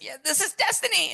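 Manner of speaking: very high-pitched proclamation; hammy, squealing guru impression; Richard Simmons. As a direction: cry it out way up high. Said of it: "I kinda do a decent impression".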